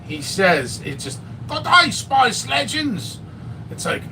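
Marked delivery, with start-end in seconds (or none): 1.5-3.2 slow, high Aussie greeting; Australian accent. Retold or imitated. Mocking the Australian reviewer again, in an Aussie accent. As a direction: drawl out the Aussie hello. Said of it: "that Australian".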